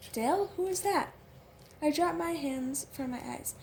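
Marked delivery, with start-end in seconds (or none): 0.1-1.1 high, louder calling out. Acted. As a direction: call up the stairs, Southern. Girl calls out question.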